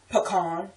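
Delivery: "pecan" as puh-KAHN; local pronunciation. Shown as a demonstration. Word-list demo of our way, "pecan" as puh-KAHN. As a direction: stress the second syllable of "pecan", AH vowel. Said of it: "but we say pecan".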